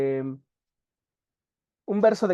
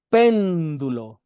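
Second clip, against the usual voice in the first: ordinary delivery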